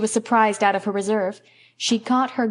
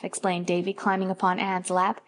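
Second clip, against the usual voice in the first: plain reading voice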